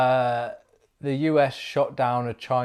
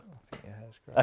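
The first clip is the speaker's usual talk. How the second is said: much softer, almost under the breath; soft repeat; 16 dB quieter than usual. Real, not acted. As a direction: say it again gently, nearly to yourself.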